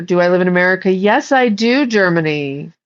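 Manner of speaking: ordinary talk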